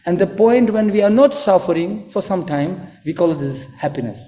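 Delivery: ordinary talk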